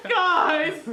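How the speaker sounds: high-pitched mocking little-girl cry. Injured friend crying out to the guys, mock wail. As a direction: imitate him wailing for his buddies. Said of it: "He was crying like a little girl".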